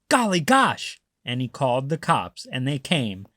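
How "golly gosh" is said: high startled exclamation; surprised farmer. Acted. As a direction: wake up surprised. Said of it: "the farmer woke and said".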